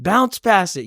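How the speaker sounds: mock yell of a frustrated sports dad; retold frustrated shouting. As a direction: call it out to the court. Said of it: "and I'm shouting".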